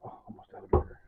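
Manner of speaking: breathy whisper, almost out of vinegar; whispered to self. Real, not acted. Cook noticing the vinegar, whispering to himself. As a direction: mutter it as a private note.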